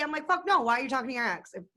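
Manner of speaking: ordinary talk, nothing marked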